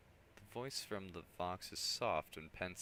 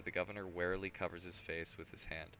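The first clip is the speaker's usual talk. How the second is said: normal talking voice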